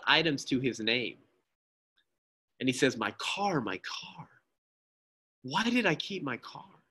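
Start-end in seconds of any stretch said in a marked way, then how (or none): 3.0-6.8 softer, then a higher anguished cry; sobbing self-reproach; weeping despair. Acted. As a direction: break down over the car. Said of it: "he begins to weep".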